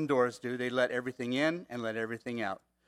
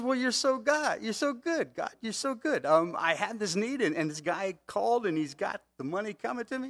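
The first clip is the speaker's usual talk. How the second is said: giddy praise; 7 semitones higher than usual. Retold or imitated. Pastor retold, giddy with relief.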